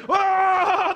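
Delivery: high, drawn-out loud yell; imitated hummingbird frenzy. Preacher mimics hummingbirds even standing still, frantic held yell. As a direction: hold a frantic high scream.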